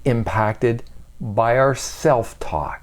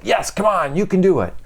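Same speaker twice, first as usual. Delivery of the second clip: "Yes, come on, you can do it." pitched higher and pushed out; burst out; 6 semitones higher than usual. Shown as a demonstration. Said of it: "you burst it out".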